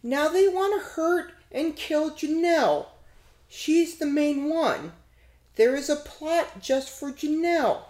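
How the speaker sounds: put-on man doing a high little girl voice. Shown as a demonstration. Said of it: "I'm gonna try to read it in, uh, Janelle's little girl voice".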